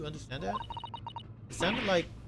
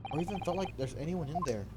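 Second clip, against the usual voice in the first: usual speaking voice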